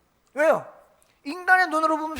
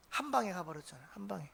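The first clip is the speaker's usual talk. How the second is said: whispered punchline; 5 semitones lower, 14 dB quieter than usual. Real, not acted.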